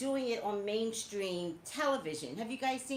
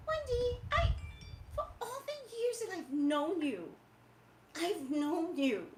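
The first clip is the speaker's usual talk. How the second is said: high-pitched wounded protest; theatrical high-voiced disbelief; mock hurt; 5 semitones higher than usual. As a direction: plead with a friend who let you down, hammed-up. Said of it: "And I was hurt".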